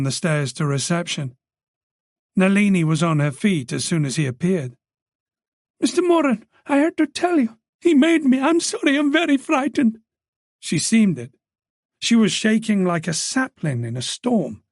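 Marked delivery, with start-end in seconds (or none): 5.8-10.0 put-on much higher, urgent young voice; frightened young woman. A narrator's reading. Frightened girl pleads with the detective, high and anxious. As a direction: blurt it out, scared and apologetic.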